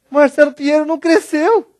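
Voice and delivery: rising, astonished high pitch; stunned realization; amazed disbelief. Retold or imitated. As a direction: marvel that he never grew. Shocked discovery of short friend.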